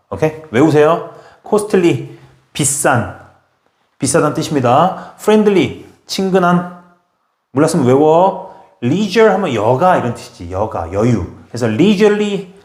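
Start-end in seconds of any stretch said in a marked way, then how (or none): none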